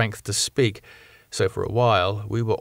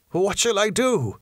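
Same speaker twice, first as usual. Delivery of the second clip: urgent plea; 10 semitones higher than usual. Acted. Arthur urgent.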